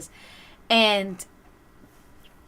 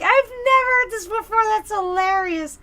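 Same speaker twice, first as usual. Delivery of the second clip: higher, amused delight; boss's wife delighted; 11 semitones higher, 5 dB louder than usual. Retold or imitated. Boss's wife praising the joke, higher amused voice. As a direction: gush like she just heard it. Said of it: "She's like".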